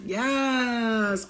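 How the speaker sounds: usual speaking voice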